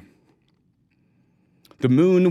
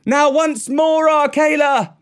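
loud cry; 14 semitones higher, 5 dB louder than usual. A narrator's reading. Mowgli calls on Akela once more, crying out. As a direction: call out long and loud to a far-off helper. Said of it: "he cried".

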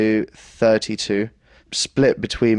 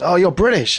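higher-pitched surprised question; quoting the driver, pitched up; imitated taxi driver; 8 semitones higher, 4 dB louder than usual. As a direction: act out a curious cab driver. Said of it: "But then he goes".

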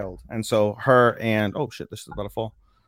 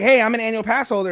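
high, loud eager boast; excited boast; 11 semitones higher, 4 dB louder, faster than usual. Retold or imitated. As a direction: show off the pass proudly. Komori voiced bragging.

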